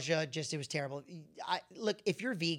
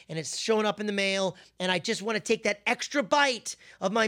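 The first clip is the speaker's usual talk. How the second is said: louder, driving sermon voice; fiery preaching; 3 semitones higher, 6 dB louder than usual. Acted. Preacher urging the congregation to fast, louder and driving.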